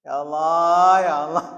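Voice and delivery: Gus Bahak crying out. Retold or imitated. Gus Bahak groaning at the crowd, loud. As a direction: call on God in distress. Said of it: "jare Gus Bahak".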